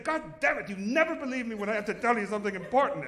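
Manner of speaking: loud, high mock outburst; hammed-up indignant yelling; mock angry. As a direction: blow up at her daughter-in-law. Mother-in-law yells in car.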